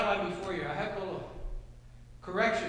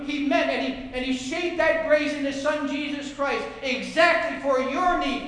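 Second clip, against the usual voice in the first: ordinary delivery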